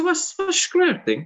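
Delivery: a high, slow baffled question; puzzled at the strange West Cork word. Retold or imitated. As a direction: ask it completely lost, pitched right up. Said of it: "I said".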